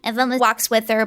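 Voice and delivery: usual speaking voice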